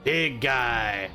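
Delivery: usual speaking voice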